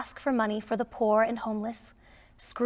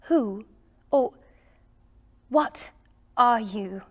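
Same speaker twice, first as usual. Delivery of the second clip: mild frightened stammer; halting, startled questions; trembling. Acted. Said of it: "cried the trembling Scrooge".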